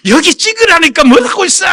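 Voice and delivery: high, loud furious yelling; angry yell. Retold or imitated. Husband yells at his wife in the polling booth. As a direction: bark the order at his wife. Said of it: "막 큰 소리가 나기 시작하는 거예요".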